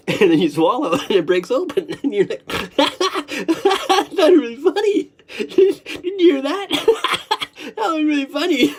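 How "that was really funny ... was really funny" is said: very high, squeaky through laughter; helpless laughing. Real, not acted. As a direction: crack up while getting the words out. Laughing at own froggy voice.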